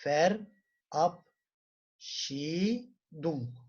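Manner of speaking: Verabschiedung very slowly, every syllable stretched; read-along model. Shown as a demonstration. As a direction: draw out Verabschiedung for the class to repeat. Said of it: "okuyalım birlikte". (Verabschiedung çok yavaş, birlikte okuma için)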